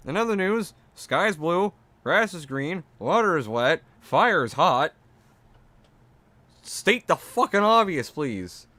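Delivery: high, mock newsreader sarcasm; sarcastic. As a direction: list it like fake news, mocking. Sarcastic listing of obvious.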